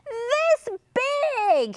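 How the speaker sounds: very high, stretched exclamation; high-pitched storybook cry. A narrator's reading. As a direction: cry it out high as an alarmed frog. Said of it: "he cried".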